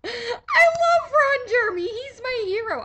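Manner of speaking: high, loud gushing fangirl; excited. Real, not acted. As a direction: squeal it out with delight. Gushing about her hero.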